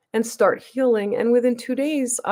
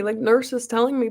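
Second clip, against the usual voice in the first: usual speaking voice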